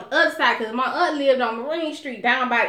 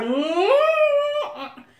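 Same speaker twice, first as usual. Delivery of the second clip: emphatic no; 14 semitones higher, 3 dB louder than usual. Retold or imitated. Refusing his marriage plan.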